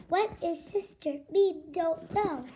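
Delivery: monotone robot voice. Acted. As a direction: answer like an uncomprehending machine. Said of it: "Unknown alien robot".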